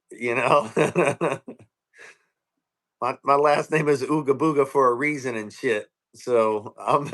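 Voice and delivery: ordinary talk, nothing marked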